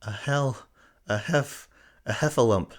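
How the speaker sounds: high, gasping, stumbling words; out of breath. A narrator's reading. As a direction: pant it out after running. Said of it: "breathing so hard he could hardly speak".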